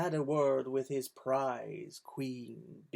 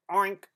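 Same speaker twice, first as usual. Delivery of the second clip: hog oink; 4 semitones higher, 7 dB louder than usual. A narrator's reading. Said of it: "said the hog".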